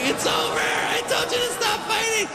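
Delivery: very high, wailing female duck; hammed-up tearful cry, climbing in pitch; mock crying. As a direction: wail it like a distraught duck ending a relationship.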